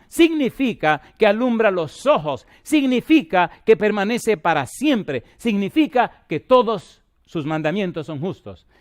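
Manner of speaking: loud, high fervent preaching, slowing on the significa lines; full-force preaching. Acted. Preacher to his congregation about joy in sacrifice, booming and fervent. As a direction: proclaim it like a revival preacher.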